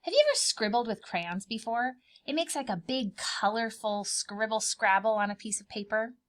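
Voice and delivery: usual speaking voice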